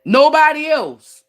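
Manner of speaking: slow, high and loud insistence; proud stretched-out claim; emphatic declaration. Real, not acted. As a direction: plant the words firmly, nobody else. Emphatic boast to viewers.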